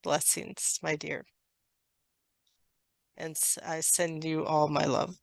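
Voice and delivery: soft, hushed closing benediction; tender blessing. Acted. Channeler sends all their love, soft tender blessing. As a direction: ease gently out of the channeling.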